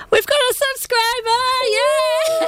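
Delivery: high, squealing excitement; excited squeal. Retold or imitated. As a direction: squeal it like a thrilled fan. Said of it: "you would get all".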